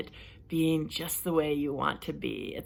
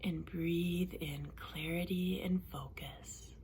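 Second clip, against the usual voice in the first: calm relaxation coach leading a breathing exercise; guided relaxation; 8 dB quieter than usual. Acted. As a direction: guide them gently through breathing, unhurried. Leads a guided relaxation session.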